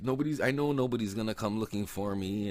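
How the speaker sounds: high-pitched pleading; retold plea. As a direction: imitate a captive pleading. Captive pleading for his life.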